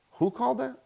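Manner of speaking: high-pitched incredulous question; startled girlfriend. Retold or imitated. Girlfriend asks about the phone call, astonished.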